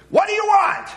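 high, loud put-on voice of a gruff professor. Student knocks after hours, annoyed professor in high loud voice. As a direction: bark it like an annoyed professor at his door.

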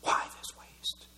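mock disciple whispering; a scandalised whisper of waste; whispered complaint. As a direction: hiss it under the breath like a grumbling disciple.

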